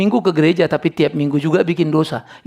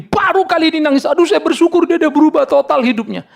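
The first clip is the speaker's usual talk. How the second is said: high-pitched mock overjoyed wife; hammed-up grateful gushing; 9 semitones higher than usual. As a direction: imitate a wife exclaiming with relief. Acts wife gushing over husband's tears.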